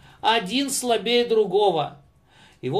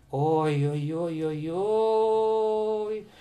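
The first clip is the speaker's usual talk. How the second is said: slow lament; 4 dB quieter, much slower than usual. Acted. Lament over defeat.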